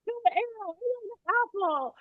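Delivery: high, soft, quick mock whine; whining child. Upset boy demanding the apple puppet, high soft whine. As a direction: whimper a fussy kid's protest softly.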